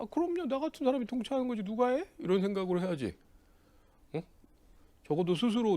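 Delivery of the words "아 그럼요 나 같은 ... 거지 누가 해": high-pitched, boastful certainty; cocky confidence. Acted. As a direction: answer as if nobody else could possibly do it. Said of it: "자신감 있게 보란 말이야".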